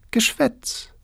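very high whispered brush-off; a high, breathless scoff at a lovesick boy; dismissive girl. Acted. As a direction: wave him off in a tiny whisper. Girl dismisses suitor's flattery.

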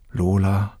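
usual speaking voice

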